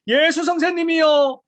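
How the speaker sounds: loud, slow, drawn-out shout; shouted plea. Retold or imitated. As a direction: shout it so he hears from far off. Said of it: "막 외치는 거예요".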